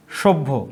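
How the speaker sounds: সভ্য said briskly at normal pace; সভ্য spoken once at normal speed; just say সভ্য plainly; quick first say. Shown as a demonstration. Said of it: "দন্ত স না".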